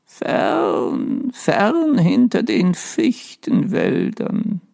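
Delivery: slow dreamy ghost voice. A narrator's reading. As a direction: drift off into a reverie. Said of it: "mit träumerischer Stimme".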